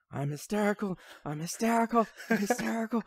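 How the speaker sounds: hysterical wailing. Retold or imitated. Retelling the famous hysterical scene, high mock wail. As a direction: wail it as if falling apart. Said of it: "he just starts screaming".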